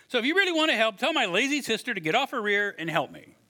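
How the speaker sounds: high, pushy demand; whiny complaint. Retold or imitated. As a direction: nag like an annoyed sister. Martha whines to Jesus.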